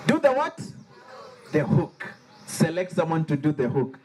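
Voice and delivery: ordinary delivery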